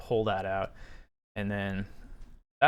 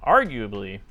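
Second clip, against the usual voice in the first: "arguably" pushed out louder, with emphasis; stress on arguably; 8 dB louder than usual. Real, not acted. Reader flagging a hedge in the paper, stress on arguably. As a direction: lean hard on the word "arguably". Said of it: "put the emphasis on".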